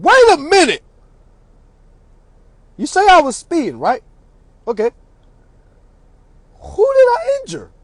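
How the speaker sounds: high, indignant courtroom questioning; high-pitched mock cross-examination; challenging objection. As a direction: stop them short and question pointedly.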